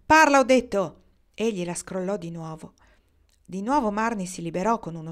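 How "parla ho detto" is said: loud, high insistent shout; raised voice, repeating a command; shouted order. A narrator's reading. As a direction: yell it, demanding obedience. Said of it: "stava urlando Joe a Marnie".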